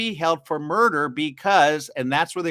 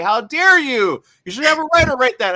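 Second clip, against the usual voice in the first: mock an offended critic, rising high and loud; mock outrage; 8 semitones higher, 8 dB louder than usual. Host imitating an outraged critic of AI.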